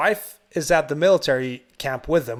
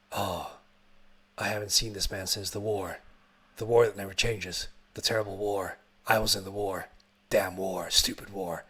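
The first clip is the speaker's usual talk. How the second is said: put-on low, soft, haunted old-priest monologue; mock-solemn muttering, lost in war memories; brooding war veteran; 6 semitones lower, 6 dB quieter than usual. Old priest flashing back to the war, low brooding parody.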